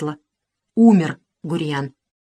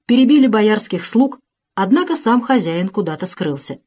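plain reading voice

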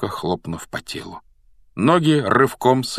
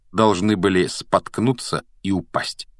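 ordinary delivery

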